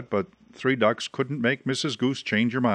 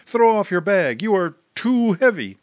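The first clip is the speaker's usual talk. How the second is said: higher, louder call from far above; a wild goose calling down from the sky; 8 semitones higher, 4 dB louder than usual. Acted. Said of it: "came a wild voice from the sky".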